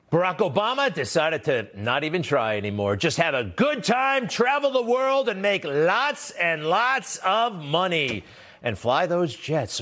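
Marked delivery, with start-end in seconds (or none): 3.0-9.8 high, sing-song sneer at the high life; mock the jet-set life, pitched up and slowing; higher sarcastic lilt, dragging out "lots and lots"; sarcastic mockery. Host mocks Obama's lifestyle.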